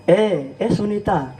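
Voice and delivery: low, slow mock village woman calling; गाँव की औरत की पुकार. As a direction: imitate her hailing a friend, unhurried. Village woman calls out to Sunita. (गाँव की औरत की धीमी पुकार की नकल)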